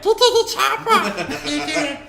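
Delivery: mock a bad impression, laughing; mock bad Arnold. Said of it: "At least Mark's wasn't like".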